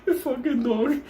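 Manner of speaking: very high, loud, squeaking through laughter; helpless laughter. Real, not acted. Storyteller loses it over the NyQuil, helplessly laughing. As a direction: laugh so hard the words barely come out.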